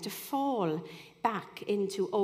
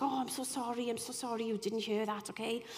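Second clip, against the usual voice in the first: a softer, embarrassed apology; hushed apology; 6 dB quieter than usual. Retold or imitated. Man caught arguing apologises to visitor, hushed and sheepish. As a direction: quietly say sorry after the row. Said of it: "the other one was like".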